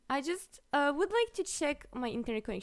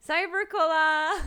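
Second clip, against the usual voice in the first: excited laughing cry; 6 semitones higher, 8 dB louder than usual. Real, not acted.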